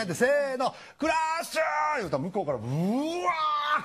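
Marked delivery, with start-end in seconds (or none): 0.2-2.0 calling out Crush. Retold or imitated. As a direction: shout the turtle's name together. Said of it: "みんなでせーのでクラッシュ言いましょうね".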